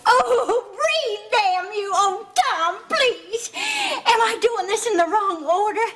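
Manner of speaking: high, desperate, near-tearful plea; frantic pleading. Acted. Mother reviving unconscious Tom, frantic pleading. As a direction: beg him to come round.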